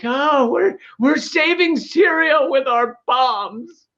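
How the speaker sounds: high-pitched mock self-congratulation; mock cheering. Host mocking Hollywood cheering at the Oscars, mock cheering. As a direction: cheer it drawn out, sarcastically. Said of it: "on their feet cheering".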